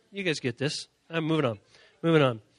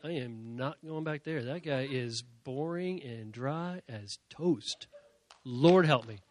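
mock bored audience; 7 semitones lower, 8 dB quieter than usual. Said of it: "Y'all be like".

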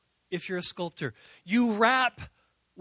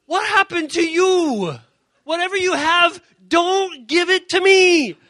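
mock friends backing away, higher and louder; alarmed friends; 9 semitones higher, 8 dB louder than usual. Friends reacting to lunchroom preaching, mock alarm. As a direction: recoil like they're scared to catch it. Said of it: "Your friends are gonna be like".

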